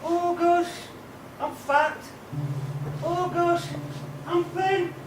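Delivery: quiet lament of a defeated inner victim. Acted. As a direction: play the small voice of self-doubt, hushed. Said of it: "That's called the victim voice".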